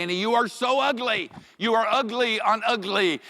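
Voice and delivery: very high, loud teasing singled at a dog; loud, high-pitched pet-teasing voice; mock insult to pet. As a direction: belt it out high in playful exaggeration. Teasing dog in acted scene.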